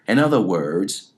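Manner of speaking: ordinary talk, nothing marked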